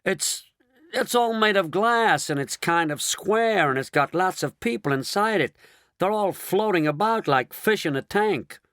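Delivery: excited. Acted. Astronaut amazed describing glass capsule.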